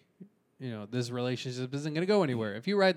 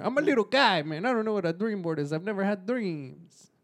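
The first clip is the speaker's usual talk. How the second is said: little guy voice; 7 semitones higher than usual. Pretend.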